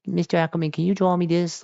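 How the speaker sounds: put-on high-pitched kid asking a favor; little kid. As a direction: imitate a small child pleading sweetly. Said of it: "little kids ask me".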